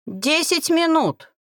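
slow, high-pitched reminder; insistent reminder. A narrator's reading. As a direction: remind them firmly of the limit. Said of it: "напомнил бородатый".

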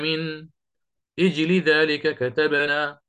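slow reading, أجل starting with ijli; student's test reading. Retold or imitated. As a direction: begin at أجل with a clear kasra. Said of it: "قال له الشيخ للطالب".

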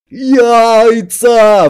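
high, loud megaphone-like yell; bellowing. A narrator's reading. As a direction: bellow it like a foghorn. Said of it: "вторил рупором папа".

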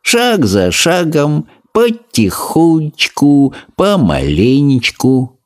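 slow, patient tortoise motto; slow creaky tortoise. A narrator's reading. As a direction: plod through it step by step.

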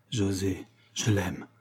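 soft, sunken admission of love; subdued confession. A narrator's reading. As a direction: confess it softly to a close friend. Confession repeated, subdued to friend.

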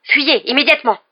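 high, loud urgent command; urgent order. Acted. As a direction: order them to flee at once. Sirius urges them to flee.

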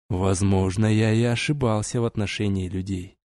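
soft, smooth dark elf voice; melodic elf voice. A narrator's reading. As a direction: speak softly, with measured grace. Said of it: "Мелодичный голос тёмного эльфа".

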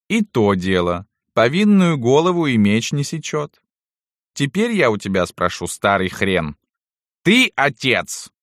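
put-on corner the old servant like his judge; domineering mistress. A narrator's reading. (властная барыня допрашивает)

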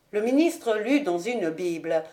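plain reading voice

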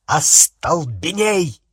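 slow, forceful shouted incantation; barked spell. A narrator's reading. Harry firing a spell at Umbridge, barking. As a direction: bark it out, stretching the word. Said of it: "и рявкнул".

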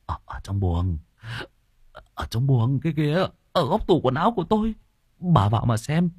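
scared son urging his mother to look; urgent son. Acted. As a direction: point her to the wardrobe anxiously.

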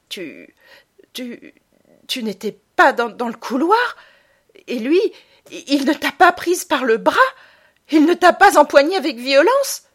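from faint stammer to loud, high outrage. Acted. Stunned boy confronting lying mother, building. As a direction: stumble then build to a cry.

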